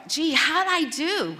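high, drawn-out hopeful question; eager self-pleased asking. Retold or imitated. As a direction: fish for praise in a high voice. Eagerly asks friend to rate German.

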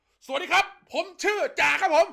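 high, formal military voice; stiff soldier greeting. Retold or imitated. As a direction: snap it out like a cadet reporting. Military guy introduced.

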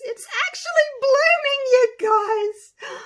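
very high, loud burst of excitement; real high-pitched excited yell; excited squeal. Real, not acted. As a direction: squeal it out, thrilled. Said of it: "how excited this makes me".

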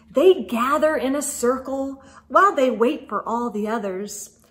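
put-on lively read-aloud to children; storybook narration. Reading a snowman story to children, lively narration. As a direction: tell it like a bedtime picture book. Reads picture book aloud.

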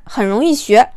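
plain reading voice

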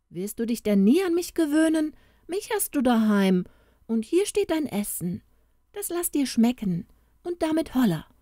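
high-voiced storybook wife chiding; bossy ogre's wife. A narrator's reading. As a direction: brush him off and order him to eat. Woman scolds and placates the ogre.

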